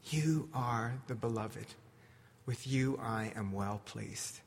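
soft, low blessing; a hushed, tender affirmation; tender Jesus. Acted. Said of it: "Jesus says".

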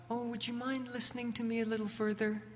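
high, soft, fast mock-polite plea; overly polite ask. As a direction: ham up a timid polite request.

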